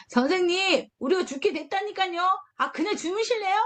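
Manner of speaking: high, grumbling nag; a doubting disciple grumbling at sleeping Jesus; complaining. Retold or imitated. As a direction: gripe at him for sleeping on. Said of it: "불평불만의 모습들로".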